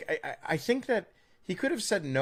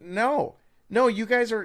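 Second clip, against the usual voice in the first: louder, higher exasperated protest; emphatic retort; 9 semitones higher, 6 dB louder than usual. Retold or imitated. Podcaster retelling his reply to Brits, loud exasperated retort. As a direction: cut in with a raised-voice objection.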